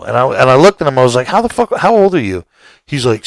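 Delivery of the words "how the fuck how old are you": higher, shocked mock interrogation; shocked demand. Homeowner grills the teenager on the couch, shocked. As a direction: demand his age in shock. Confronting the young intruder.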